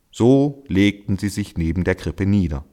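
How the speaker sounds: ordinary delivery, nothing marked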